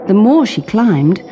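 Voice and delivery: usual speaking voice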